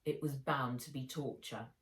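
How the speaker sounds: ordinary delivery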